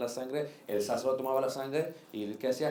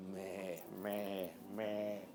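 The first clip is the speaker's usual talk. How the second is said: soft imitated bleat, much quieter; sheep bleating; 6 semitones lower, 10 dB quieter, much slower than usual. Preacher imitating the sheep drinking, with soft bleats. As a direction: bleat like the thirsty sheep, softly.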